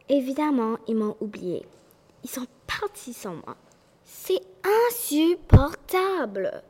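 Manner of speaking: loud, outraged little tree; furious outburst at being left; angry. Acted. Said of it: "dit-il rouge de colère".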